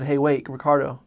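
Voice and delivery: loud, urgent call; calling for attention. Retold or imitated. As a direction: call out to stop someone closing the door. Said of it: "to get his attention".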